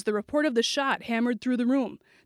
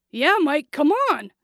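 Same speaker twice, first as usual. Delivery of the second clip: higher, louder, urgent agreement; anxious plea; 5 semitones higher, 5 dB louder than usual. A narrator's reading. Cornered boy backing the girl's appeal, anxious pleading. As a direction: chime in pleadingly. Billy begs the gunman.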